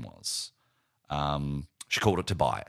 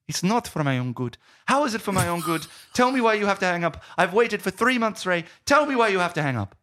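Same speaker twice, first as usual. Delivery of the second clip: put-on very high, insistent girlfriend voice; hammed-up pleading, loud and high; upset Chloe; 14 semitones higher, 4 dB louder than usual. Girlfriend demanding to know why he must hang up, high-pitched insistence. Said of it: "And she goes".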